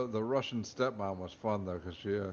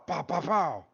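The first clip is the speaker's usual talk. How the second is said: louder voiced gunshots; mock gunshot sounds; 7 semitones higher, 4 dB louder than usual. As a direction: spit out three cartoon gunshots.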